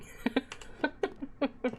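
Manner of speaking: soft, breathy chuckle; laugh. Real, not acted. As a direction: laugh softly to yourself. Chuckles at own game attempt.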